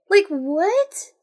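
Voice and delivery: high, loud, rising disbelief; incredulous. Real, not acted. Reader baffled by the repentance, incredulous. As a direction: throw it up in outrage. Reader rants about the story.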